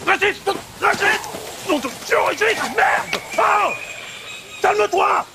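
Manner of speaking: high-pitched frantic shouts; urgent yelling. Acted. Man restrains his enraged friend, urgent high yelling. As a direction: yell to pull a friend off.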